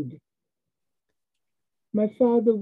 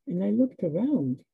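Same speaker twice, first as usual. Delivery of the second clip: usual speaking voice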